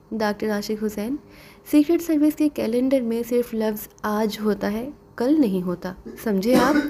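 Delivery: ordinary delivery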